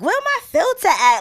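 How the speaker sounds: high voice. Retold or imitated. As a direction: toss it off high and bright. [name] voiced in story.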